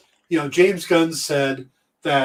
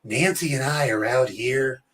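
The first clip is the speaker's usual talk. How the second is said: Reagan impression. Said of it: "Everybody goes".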